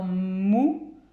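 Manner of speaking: "mou" drawn out from a long m; stretched first sound. Shown as a demonstration. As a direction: dwell on the m opening "mou". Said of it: "le premier son que j'entends". (« mou » allongé, m initial tenu)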